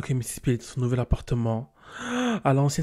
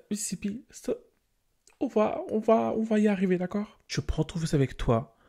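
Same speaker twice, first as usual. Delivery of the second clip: reassuring; 8 semitones higher than usual. Acted. Comforting Sim.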